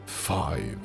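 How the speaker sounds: usual speaking voice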